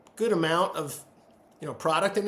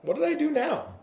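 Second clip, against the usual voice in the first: high-pitched playful bewilderment; comic put-upon cat owner; mock exasperated; 10 semitones higher than usual. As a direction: act wrongly accused, voice lifted high. Acts out replying to yelling cat.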